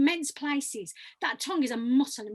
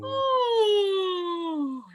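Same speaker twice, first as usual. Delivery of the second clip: a higher, big open yawn on ooh; 5 semitones higher than usual. Shown as a demonstration. Coach demonstrates again, a high yawning ooh. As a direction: lift and yawn it out. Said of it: "I just want you to yawn".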